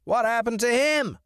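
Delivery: high, bright bird voice; a chirpy crow asking. Acted. Crow character asks question.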